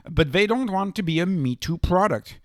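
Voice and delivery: ordinary talk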